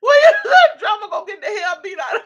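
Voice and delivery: high, loud, fast words breaking into laughter; can barely get it out for laughing; laughing hard. Real, not acted. Laughing at a viewer's comment, real laughing fit.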